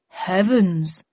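mildly startled exclamation; a touch of alarm; exclaimed. A narrator's reading. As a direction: exclaim in understated astonishment. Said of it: "exclaimed Wilbur".

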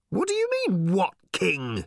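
very high shout, dropping at the end. A narrator's reading. Ford challenging Arthur's question, high shout. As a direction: shoot up high in protest. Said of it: "shouted Ford".

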